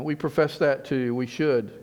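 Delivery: usual speaking voice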